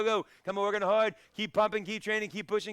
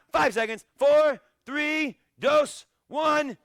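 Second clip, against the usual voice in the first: much higher, loud yell on each number; shouted countdown; 9 semitones higher, 8 dB louder, much slower than usual. Real, not acted. Instructor counting down the bonus round to his students, shouting. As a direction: bark the count to the finish.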